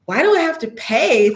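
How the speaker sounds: complaining attitude. Retold or imitated. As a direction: imitate a put-out churchgoer objecting. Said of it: "Some of them have the attitude".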